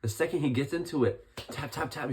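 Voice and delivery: ordinary delivery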